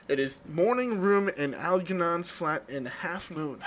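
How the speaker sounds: slow, careful play reading; reading stage directions. Acted. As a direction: set the scene slowly.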